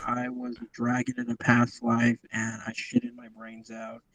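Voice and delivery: starts clear, then fades out; fading away from mic. Real, not acted. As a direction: voice trailing off as if moving away from the mic. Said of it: "sounds like he's running away from the mic while talking".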